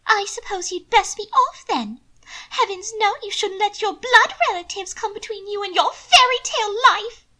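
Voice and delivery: high, loud haughty lady; high haughty young lady; proud sarcasm. A narrator's reading. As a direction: toss it off with lofty scorn. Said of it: "stubborn and proud".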